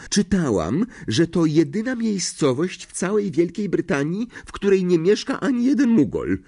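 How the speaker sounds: high, prim schoolgirl voice; know-it-all girl. A narrator's reading. Hermiona lectures Ron and Harry, prim high voice. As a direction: recite it as the class know-it-all. Hermiona shows off her reading.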